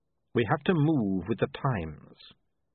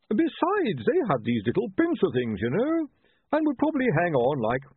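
high-pitched, earnest priest voice; chief priest; 9 semitones higher, 4 dB louder than usual. Acted. Chief priest rambles in debate.

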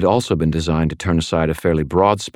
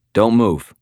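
sharp, louder command at gunpoint; warning; 6 semitones higher, 4 dB louder than usual. Acted. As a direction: warn her off, firm. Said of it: "I warned her".

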